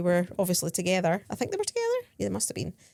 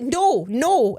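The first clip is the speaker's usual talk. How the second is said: raised, louder mock refusal; retold insistent refusal; 4 semitones higher, 7 dB louder than usual. Tourist handing the rose back, retold loud refusal. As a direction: push back harder, voice lifted.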